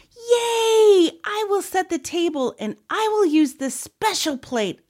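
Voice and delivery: put-on high, loud cheering little girl; high-pitched gleeful child; excited. A narrator's reading. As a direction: burst out cheering, then gush eagerly. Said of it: "said with excitement".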